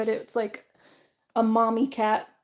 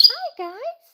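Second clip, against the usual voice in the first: cooing pet talk; 11 semitones higher, 3 dB louder than usual. Real, not acted. Owner greets her birds after a day away, high cooing pet talk. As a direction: coo at them like they're babies.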